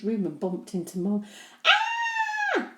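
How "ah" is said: high startled shriek. Acted. Henry bumps into Mum in the dark, shrieking. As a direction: yelp as if caught sneaking. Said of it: "shrieked Henry".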